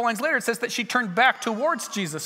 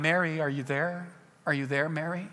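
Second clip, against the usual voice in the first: gentle call; 4 semitones lower, 5 dB quieter than usual. Acted. Jesus calling the freshly healed Mary.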